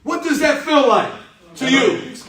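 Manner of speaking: high and loud, leaning on feel; loud emphatic question. Shown as a demonstration. Coach pressing a boy about his feelings, loud. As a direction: push the boy for a real answer. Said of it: "No, no, I gave you that".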